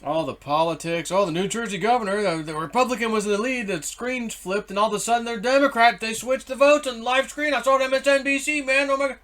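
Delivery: high-pitched, climbing mock political outcry; breathless news-outrage parody; frantic political rant. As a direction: imitate someone getting worked up, pitch rising throughout. Mimics frantic political chatter.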